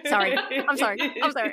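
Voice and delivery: high, loud, laughing mock apology; re-enacted apology. Host replaying apologies to co-hosts for swearing, mock apologetic. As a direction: blurt repeated apologies through a laugh. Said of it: "And then I go".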